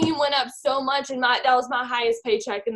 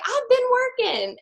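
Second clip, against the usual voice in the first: whiny protest; 13 semitones higher, 3 dB louder, slower than usual. Retold or imitated. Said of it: "I was like".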